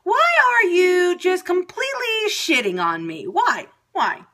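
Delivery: high, strained mock outburst at the day, slowing into a yell; venting at Tuesday, pitch climbing, shouting the end; exasperated rant. Ranting at a rotten Tuesday, rising exasperated yell. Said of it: "I'm just like".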